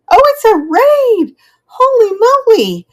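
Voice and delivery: thrilled gasp at the sudden raid; excited surprise. Real, not acted. A raid arrives on stream.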